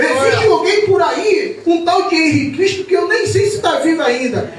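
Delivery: loud, high, impassioned sermon delivery; fiery preaching. Acted. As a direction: preach it out to the congregation. Preaching at full force.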